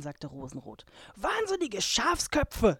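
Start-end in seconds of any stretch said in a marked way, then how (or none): 1.2-2.8 slow, drawn-out grumpy snarl; dwarf snarling insults, slow and high. A narrator's reading. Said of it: "schnarrte der Zwerg".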